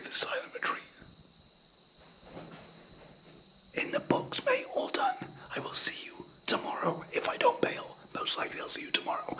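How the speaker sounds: hushed whisper so no one hears. Real, not acted. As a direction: whisper it, breathy and secret. Whispering vlog at retreat.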